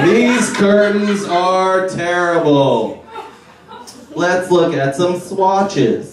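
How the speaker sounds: high, slow, fussy camp. Pretend. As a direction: ham up a fussy decorator. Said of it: "And I'm like".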